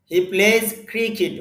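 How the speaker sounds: ordinary delivery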